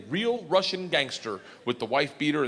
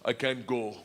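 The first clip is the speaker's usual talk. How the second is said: put-on Russian accent; 5 semitones lower, 4 dB quieter, slower than usual. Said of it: "And he goes".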